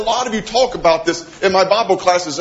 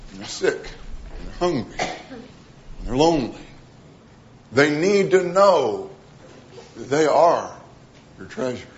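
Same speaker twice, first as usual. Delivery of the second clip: normal talking voice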